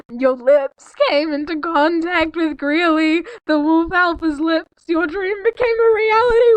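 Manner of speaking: barely holding it together reading; laughing-crying read. Real, not acted. Reading kiss scene, crying sound.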